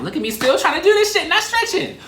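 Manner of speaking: high, excited squeal at herself; amused shriek while stretching; squealing self-mockery. Real, not acted. Laughing at self mid-stretch.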